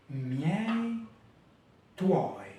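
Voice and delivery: Miei, tuoi, each one gliding syllable; careful Italian triphthongs. Shown as a demonstration. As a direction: model Miei and tuoi slowly. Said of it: "les mots avec des triphtongues".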